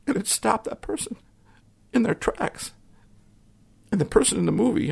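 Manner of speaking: real emotion catching as you tell it; emotional. Real, not acted. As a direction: voice rising high, choked with feeling. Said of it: "getting a little emotional here".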